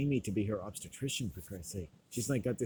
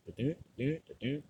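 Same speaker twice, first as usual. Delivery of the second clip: higher rhythmic machine chug; imitated machine noise; 4 semitones higher than usual. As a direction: mimic a pumping machine in repeated beats. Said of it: "this part of the machine is like".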